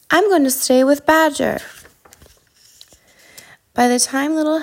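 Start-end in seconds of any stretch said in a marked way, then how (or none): 0.1-1.6 higher-pitched, mild storybook cry. A narrator's reading. Hedgehog running on through the storm, calling out mildly. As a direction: call it out lightly. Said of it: "he cried".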